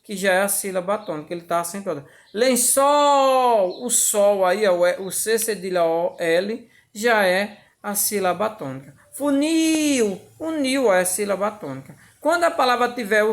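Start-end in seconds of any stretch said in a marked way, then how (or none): 2.3-3.8 "Lençol" with ÇOL held long, high and loud; singsong stretched syllable. Shown as a demonstration. Teacher marking the tonic syllable, singsong "Lençol" with long final ÇOL. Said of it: "como se tivesse cantando". 9.1-10.2 "Funil" with NIL raised high and drawn out; singsong stretched syllable. Shown as a demonstration. Teacher marking the tonic syllable, singsong high "Funil" with long NIL. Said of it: "como se tivesse cantando".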